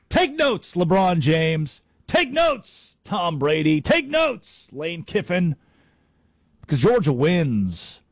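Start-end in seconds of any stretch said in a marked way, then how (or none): 0.1-0.7 mock command. Mock preaching to star athletes. 2.1-3.0 high, ringing order to take notes; mock command. Host taunting LeBron, Brady, Kiffin with a high call. 3.8-4.7 high, ringing order to take notes; mock command. Host taunting LeBron, Brady, Kiffin with a high call.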